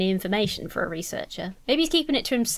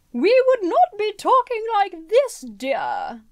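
high, loud put-on Essex lady voice, drawn out; mock Essex lady; 11 semitones higher, 5 dB louder, slower than usual. Player mocking how the game's Essex characters should sound, in a loud high put-on Essex lady voice. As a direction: ham up a proper Essex lady. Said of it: "a proper Essex lady".

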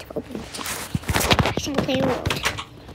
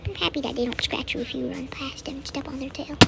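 whisper; 9 dB quieter than usual. Real, not acted. Quiet remark on cats.

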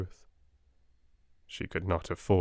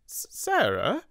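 much higher, a frightened stammer; 10 semitones higher, slower than usual. Acted. Timid sister stammers to the headmistress in fright. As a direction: trip over the name in alarm. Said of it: "she stammered".

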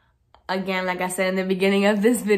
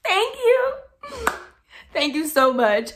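high, bright delighted thank-you; sweet gushing thanks; 12 semitones higher, 3 dB louder, slower than usual. Real, not acted. Vlogger thanking a commenter for a compliment, delighted. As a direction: beam and thank them sweetly.

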